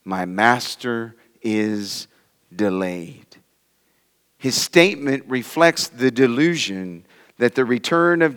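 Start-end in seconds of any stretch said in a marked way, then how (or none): 0.0-3.2 slow, low and soft, as private thought; hushed, drawn-out inner voice; said in himself. Retold or imitated. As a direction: think it aloud softly, word by word. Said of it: "he said in himself".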